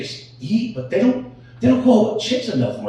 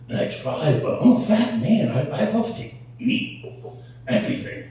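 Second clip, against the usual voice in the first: ordinary talk, nothing marked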